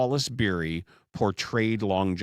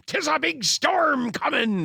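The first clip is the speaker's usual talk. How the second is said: put-on high, hammy old sea captain; pirate captain voice; 3 dB louder than usual. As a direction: warn of the storm like a salty captain. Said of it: "that State Farm commercial".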